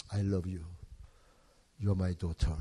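low, soft and slow loving father; tender fatherly words. Retold or imitated. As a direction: say it gently, like holding a child close. Father tenderly embracing adopted daughter.

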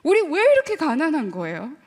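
very slow, drawn-out whine; slow complaint to God. Retold or imitated. Poor child complaining to God, slow pouty whine. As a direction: drag out the grievance, pouty. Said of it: "하나님한테 대한 불만이 있었어요".

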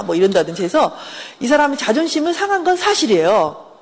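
ordinary talk, nothing marked

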